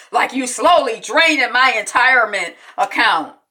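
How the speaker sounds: hammed-up loud accusation; mock arguing father. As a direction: imitate an exasperated dad in a row. Acting out father in argument.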